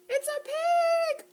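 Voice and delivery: high, slow delighted shout; excited reveal. Acted. Librarian announcing the pig to kids, excited. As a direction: announce it with glee.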